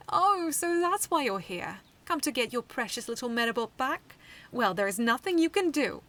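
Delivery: mock the kids, savouring it; taunting. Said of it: "Seaslug taunted".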